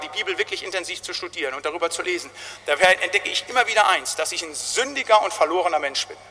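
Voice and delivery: usual speaking voice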